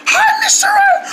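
high, strained hoarse squeak; lost voice. Acted. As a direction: squeeze the greeting out through a lost voice. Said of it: "Why do you sound like that?".

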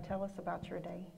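ordinary talk, nothing marked

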